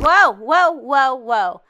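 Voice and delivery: loud string of startled whoas. Real, not acted. Player tumbles into a pit, startled cries. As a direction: cry out as if suddenly dropping.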